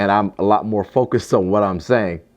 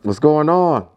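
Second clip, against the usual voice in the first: high, loud hype opener; excited greeting; 7 semitones higher, 4 dB louder, slower than usual. Real, not acted. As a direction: shout out the welcome back.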